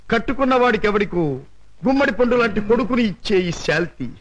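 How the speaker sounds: ordinary talk, nothing marked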